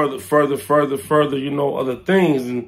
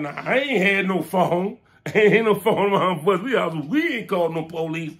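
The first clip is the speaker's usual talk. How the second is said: ordinary talk, nothing marked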